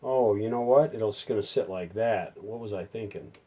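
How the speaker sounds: usual speaking voice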